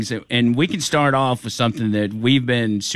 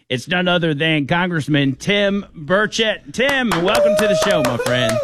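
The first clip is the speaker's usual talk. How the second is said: higher, building announcer intro; radio host welcoming a congressman; hyped guest intro; 7 semitones higher than usual. Acted. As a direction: bring the guest in with a lift.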